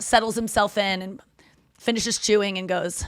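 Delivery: ordinary talk, nothing marked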